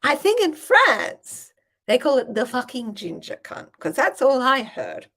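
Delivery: high, loud feigned sophistication; mock innocence. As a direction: keep up the innocent act with airy assurance. Teasing dad before the visiting farmers.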